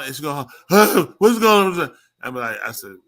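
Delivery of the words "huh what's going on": loud, higher-pitched startled holler, drawn out; imitated holler. Retelling her waking up screaming, imitated holler. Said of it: "she's gonna holler".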